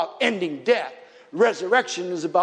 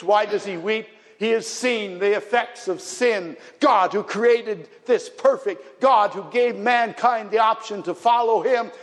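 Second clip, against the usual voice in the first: higher, rising preacher's fervour; impassioned preaching; 6 semitones higher than usual. Acted. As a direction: preach it with mounting passion. Preaches on the effects of sin.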